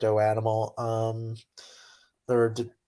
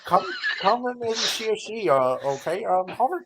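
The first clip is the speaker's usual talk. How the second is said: lightly voiced high-pitched impersonation; mock challenge in a higher voice; Ken Hovind; 9 semitones higher than usual. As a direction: imitate a debater's invitation, pitched up. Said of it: "was saying, like, his voice thing".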